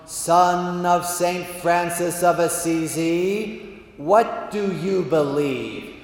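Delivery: slow, sonorous chant-like question; God. Acted. God questions the Franciscan, slow and majestic. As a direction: intone each word grandly. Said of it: "says from his great golden throne".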